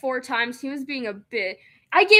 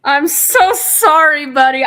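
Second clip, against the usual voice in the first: slow, much louder, hammed-up sorry; loud exaggerated apology; 4 semitones higher, 11 dB louder, slower than usual. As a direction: overdo the apology, stretch the so. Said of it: "look how sad his face looks".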